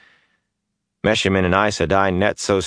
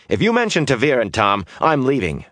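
put-on higher, eager young rogue voice; brash young man; 6 semitones higher than usual. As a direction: restless youth pleading to leave. Mat's voice again.

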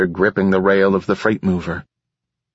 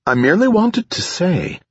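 high, polite machine voice; a fussy, precise artificial mind; prim AI voice; 10 semitones higher than usual. A narrator's reading. AI Enduring Bias speaks.

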